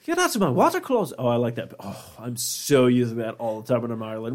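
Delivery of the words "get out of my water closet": high-pitched mock indignation; imagined priest's put-on voice. Priest shooing visitors from water closet, high mock outrage. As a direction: shoo them out in a high silly voice. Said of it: "He's like".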